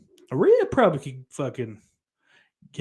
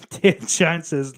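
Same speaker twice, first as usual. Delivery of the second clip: normal talking voice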